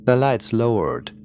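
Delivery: ordinary delivery, nothing marked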